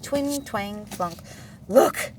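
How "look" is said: wheezing. A narrator's reading. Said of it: "Bear wheezed".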